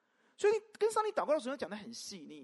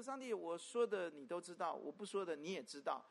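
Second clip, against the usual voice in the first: 1 mock lazy prayer; 4 semitones lower, 7 dB quieter than usual. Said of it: "要不然就是跟神祷告".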